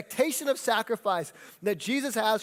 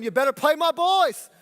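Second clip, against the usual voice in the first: louder, higher, laughing demand; pushy soccer mom; 6 semitones higher, 8 dB louder than usual. Retold or imitated. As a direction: bark it like a pushy sideline mom. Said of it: "she was a first century soccer mom".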